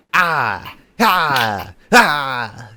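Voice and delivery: Finn Bálor impression; loud wrestler chant; warning ah ah ah. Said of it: "Finn would be a good one. Just go like".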